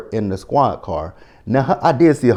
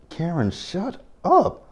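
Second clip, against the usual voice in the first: fed up; 7 semitones higher, 4 dB quieter, slower than usual. Retold or imitated. Repeated plea at Karen.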